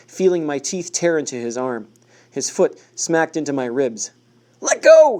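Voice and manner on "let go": high, loud pained yell; shout. A narrator's reading. Man bitten by the dog, loud shout. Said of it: "he shouted".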